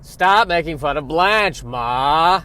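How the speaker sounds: loud, slow scolding bellow; badly faked sitcom daughter telling off her mother; Bea Arthur. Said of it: "the worst impression of Bea Arthur".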